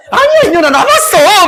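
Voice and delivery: 아주 높은 목소리로 웃으며 다투는 처녀 흉내; 웃음 섞어 새침하게 받아치기. Acted. 처자들끼리 다투는 장면. (처자들끼리 다투듯, 아주 높게 웃으며)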